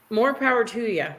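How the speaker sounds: ordinary talk, nothing marked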